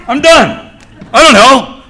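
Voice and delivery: high, loud comic freak-out; mock panic. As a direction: yelp it like an overwhelmed newcomer. Acting out panic at greeters' question.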